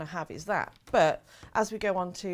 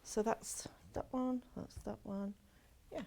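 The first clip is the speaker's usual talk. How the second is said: whispering; 11 dB quieter than usual. Real, not acted. Muttering to herself while sorting.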